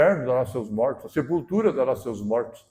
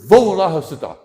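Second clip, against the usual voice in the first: bold call; 4 semitones higher, 10 dB louder than usual. Retold or imitated. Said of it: "se impertigou".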